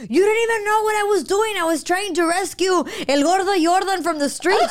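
high, fast indignant yelling; mock road rage outburst; retold rant. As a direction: tell him off through the window. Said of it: "I was like".

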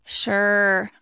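drawn-out, loud, unenthusiastic "sure"; reluctant sure. Shown as a demonstration. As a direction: drag out SURE as if you don't want to go. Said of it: "I could say; it means two different things".

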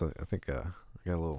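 hoarse catch in the throat; frog in throat. Real, not acted. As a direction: talk past a frog in your throat. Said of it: "a frog in my throat".

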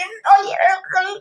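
high, strained mock giggling words; imitating laugh-choked speech. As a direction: try to talk through imitated hysterics. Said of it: "pero no me lo decía". (imitando hablar ahogada de risa)

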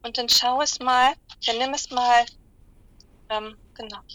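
slow, calm meditation voice; guided meditation. Acted. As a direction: guide them gently into relaxation. Guided animal visualization.

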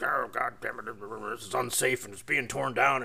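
a hint of a crusty, grumbling old man, slow at the end; cranky old owner. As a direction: mildly imitate the grumpy sixty-something owner. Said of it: "he shows up all cranky".

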